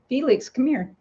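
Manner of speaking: high call to the cat; high coaxing call to a pet. Real, not acted. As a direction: coax the cat over, high. Calling her cat.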